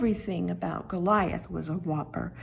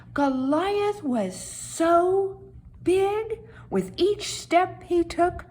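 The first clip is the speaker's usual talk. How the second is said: big, slow storyteller build; dramatic slow; 5 semitones higher, slower than usual. A narrator's reading. Narrator makes the giant sound enormous, slowly. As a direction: stretch it out, huge and slow.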